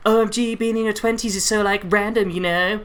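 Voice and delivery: mock American social-media gush; fake American accent. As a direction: put on a ditzy American accent. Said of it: "that was very close to it".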